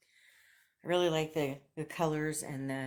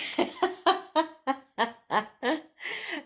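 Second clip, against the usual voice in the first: long, loud, high laugh; real laughter; 12 semitones higher, 7 dB louder than usual. Real, not acted. Vlogger laughing over Brad hating her shirt, laughing loudly. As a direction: burst out in a run of high laughs. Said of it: "I'm just laughing".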